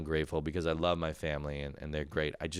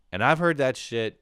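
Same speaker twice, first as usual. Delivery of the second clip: ordinary talk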